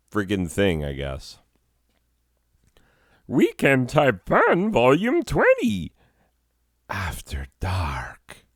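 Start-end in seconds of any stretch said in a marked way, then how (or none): 3.3-5.9 high, loud announcer intro. Acted. Announcing the show title. 6.9-8.4 slow, creepy whispered title. Acted. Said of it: "was seriously creepy".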